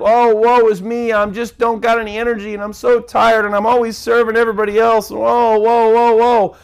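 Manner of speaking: mock woe-is-me whine. Preacher mocking a self-pitying listener's whiny moan. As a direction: whine it like a tired martyr. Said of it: "Maybe you've been like".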